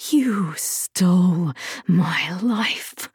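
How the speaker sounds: slow, whispery hiss; hushed, seething menace; venomous hiss. A narrator's reading. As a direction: hiss the accusation, drawing out each word. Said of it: "Rufina hissed".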